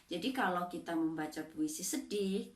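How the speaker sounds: normal talking voice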